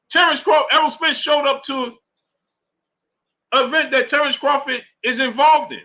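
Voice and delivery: high-pitched, worked-up disbelief; indignant. Real, not acted. Streamer pushing back on a chat comment, high and indignant. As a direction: voice jumps up in outrage at the nonsense. Indignant rant against a viewer's claim.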